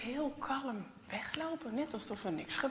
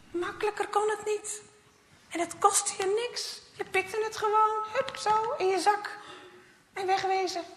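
higher, louder cocky boast; 9 semitones higher, 5 dB louder than usual. Acted. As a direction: crow about how easy it was. Boastful Jasper thoughts. (opschepperig en hoog)

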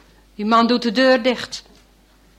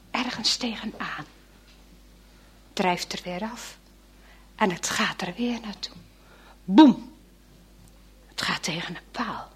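whisper; 4 semitones lower, 6 dB quieter than usual. A narrator's reading. Tense whispered narration.

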